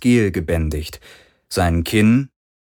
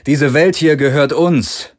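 higher, louder man's voice, calm and persuasive; Parker's voice; 7 semitones higher, 5 dB louder than usual. A narrator's reading. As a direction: win them over, promise safety. Parker asserts claim.

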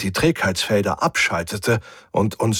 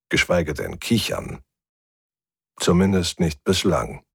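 ordinary delivery, nothing marked

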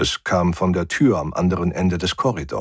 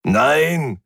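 annoyed, higher drawn-out refusal; growled no; 7 semitones higher, 4 dB louder than usual. A narrator's reading. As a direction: snarl back in frustration. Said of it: "knurrte er". (gereizt geknurrt)

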